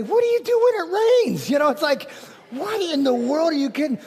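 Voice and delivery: high, loud disbelief, almost shouting; incredulous retort. Retold or imitated. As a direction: toss the question back, amazed. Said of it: "And I wanted to say".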